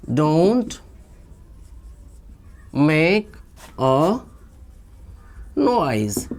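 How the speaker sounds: long pauses between single words; word-by-word dictation. Shown as a demonstration. Teacher introducing an English command to learners, word-by-word dictation. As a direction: hand out the words slowly, one by one.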